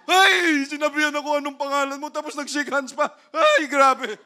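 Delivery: high, loud, fast lovestruck squeal with a laugh; girl gushing that the man asked her name, giggly and loud; giddy squeal. Acted. Smitten woman squealing.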